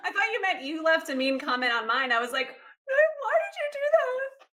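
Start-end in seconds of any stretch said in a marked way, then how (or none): 2.5-4.5 high, drawn-out plaintive whine; mock dismay. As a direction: ask it slowly in a high, put-on voice. Acted upset reply to imagined comment.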